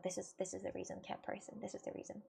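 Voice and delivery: soft whispered confidence; whispered aside. Real, not acted. Aside to a viewer.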